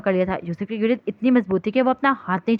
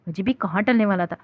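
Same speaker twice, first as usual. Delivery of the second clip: ordinary delivery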